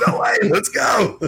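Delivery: high-pitched thrilled exclamation, acted out; re-enacted excitement. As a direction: replay your amazed delight at the news. Re-enacting own thrilled reaction.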